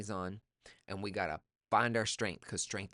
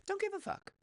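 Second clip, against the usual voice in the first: high-pitched carefree voice; high careless chirp; carefree kid. Retold or imitated.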